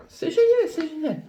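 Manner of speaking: squeaky high delighted "génial"; exclamation très aiguë. Real, not acted. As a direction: shriek "c'est génial" with joy. ("c'est génial" crié tout en haut de la voix)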